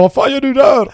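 hammed-up outraged father yelling; dad scolding son for visiting grandparents; furious dad. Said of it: "han skulle bli rasande".

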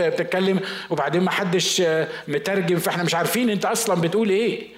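usual speaking voice

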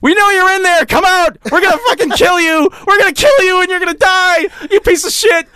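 high-pitched mock shouting threats; threatening kid. Attackers outside the house demand he come out, shrieky mock threats. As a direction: imitate a kid yelling death threats through a door. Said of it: "And then I just hear".

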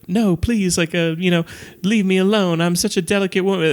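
high, dainty falsetto of a delicate lady; high-pitched mock damsel plea; mock drag voice. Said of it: "breaks the drag voice".

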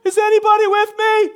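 loud, high mock cheering from the bench; sideline cheerer. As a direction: cheer like a spectator who won't play. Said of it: "sitting on the sidelines going".

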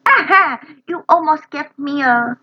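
imitating startled Lenny. Learner echoing Lenny scared by his brother, startled. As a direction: jump as if scared by your brother. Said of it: "Lenny被他的哥哥Frank吓到了".

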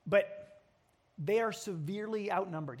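usual speaking voice

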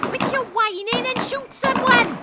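loud, impatient bawl; yelled order. Acted. Cab driver yelling at passenger mid-gunfight, impatient shout.

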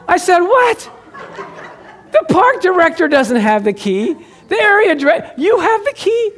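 a real laugh spilling into the retold shout; laughing disbelief. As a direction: squeal in amazed disbelief. Disbelief that the man has the key.

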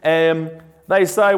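ordinary delivery

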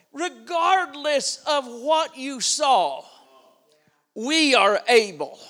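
slow, loud, unshakable; firm declaration. Acted. Preacher voicing the spies, slow and defiant. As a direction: declare it like a battle cry.

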